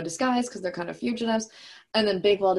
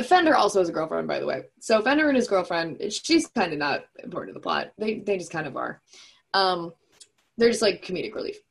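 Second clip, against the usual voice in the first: normal talking voice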